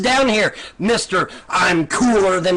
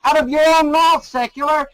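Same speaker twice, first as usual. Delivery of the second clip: higher and louder, ramming each word home; pitched-up accusing repetition; heated insistence; 7 semitones higher, 3 dB louder than usual. Real, not acted. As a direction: keep throwing it back at him. Host hammering guest's own words.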